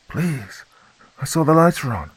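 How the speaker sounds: high pleading woman's voice; trembling fear. A narrator's reading. Woman at the door begging the girl, trembling. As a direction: beg with a trembling voice. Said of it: "fear in her trembling voice".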